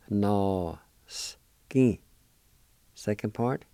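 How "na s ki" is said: "Na, s, ki" spoken as separate, spaced syllables. Shown as a demonstration. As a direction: sound out "Na, s, ki" one piece at a time. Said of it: "First part is pronounced like this".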